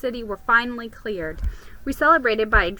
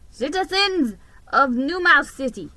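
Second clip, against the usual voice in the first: mayor announcing; 3 semitones higher, 5 dB louder than usual. A narrator's reading. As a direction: address the whole city from the podium. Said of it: "he announced".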